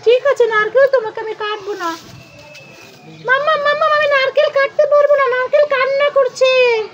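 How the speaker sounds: put-on high, urgent kid voice; worried child. Child telling mummy the coconut is crying, high and urgent. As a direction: run to mum with bad news.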